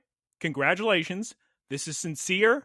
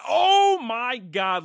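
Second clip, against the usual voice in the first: high, drawn-out exclamation; hyped-up battle recap gasp of awe; dramatic amazement; 5 semitones higher, 4 dB louder, much slower than usual. Acted. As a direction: stretch the OH out big and high. Reacting to sudden fast attack.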